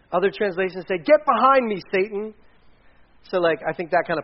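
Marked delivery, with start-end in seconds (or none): 1.0-2.3 loud, high-pitched command; stern reprimand. Acted. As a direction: rebuke him forcefully, pushing him back. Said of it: "Then he reprimanded Peter".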